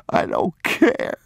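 put-on high, breathless sobbing child's voice, slow. Boy defying his father while sobbing, high and slow. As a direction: choke the words out through sobs as a small boy.

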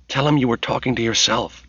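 ordinary delivery, nothing marked

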